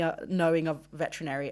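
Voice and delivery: ordinary delivery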